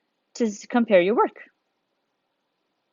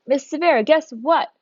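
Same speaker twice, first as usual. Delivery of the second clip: higher, lightly acted eager student; imitated student; 6 semitones higher, 3 dB louder than usual. Student Mason telling the teacher news, lightly imitated. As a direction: play a pupil announcing news to the teacher. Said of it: "Mason doesn't say".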